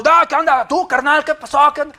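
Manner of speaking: high, loud, rowdy buddy greeting; old street-slang greeting. Retold or imitated. The old friend greeting you rowdy and loud, high and loud. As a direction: shout it like an old rowdy pal. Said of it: "Antes te hablaba todo".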